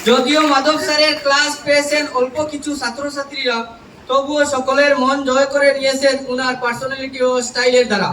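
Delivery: loud, measured public recitation of verse; poem recitation. Acted. Trainee reciting a farewell poem to college teachers, declaimed loudly. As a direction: declaim rhymed tributes line by line, pausing between phrases.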